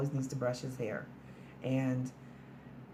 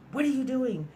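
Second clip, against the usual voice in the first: high, raised-voice imitation of a cop; urgent police order; 10 semitones higher, 3 dB louder than usual. Cop pulls the gun on her, urgent high-pitched order. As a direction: act out a panicked cop's sharp commands.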